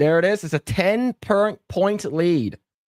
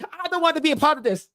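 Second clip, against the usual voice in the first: much higher, fast mock panic; Chris Tucker impression; 10 semitones higher, faster than usual. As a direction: rattle it off high like Chris Tucker. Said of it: "He's like".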